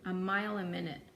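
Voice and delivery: ordinary delivery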